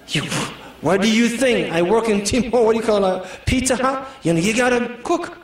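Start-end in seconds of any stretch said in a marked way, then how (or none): none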